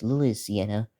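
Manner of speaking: ordinary talk, nothing marked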